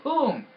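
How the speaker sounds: high, loud falling boom; explosive "boom" sound effect; imitated crash. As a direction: voice an impact with one big burst.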